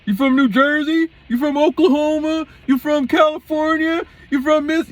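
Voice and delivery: high-pitched mock interrogation; put-on high yell, each question pushed out; imitated shouting. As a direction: mimic a man hollering one question after another. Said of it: "why you're shouting at me".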